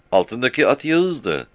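put-on young boy answering fast; shepherd boy. Frightened shepherd boy answering the captain, high child voice.

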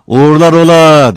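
high, loud answering call; shouted farewell. A narrator's reading. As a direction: call back to someone far away. Farewell called back from the train. (yüksek sesle uzaktan uğurlama)

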